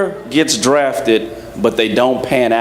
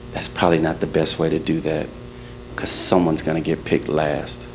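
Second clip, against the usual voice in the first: low, soft aside much lower; mentor whispering; 8 semitones lower, 7 dB quieter than usual. Retold or imitated. Mentor quietly warning him about picking teams, hushed. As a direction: murmur it lower and much softer, close to his ear. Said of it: "he whispers in my ear".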